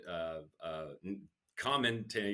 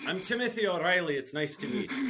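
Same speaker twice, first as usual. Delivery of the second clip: higher, lightly Irish introduction; Irish accent; 11 semitones higher, 5 dB louder than usual. Retold or imitated. Stepping into the circle of frat guys, a light Irish accent. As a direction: introduce yourself, confident and Irish. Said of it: "nicknamed the Dubliner".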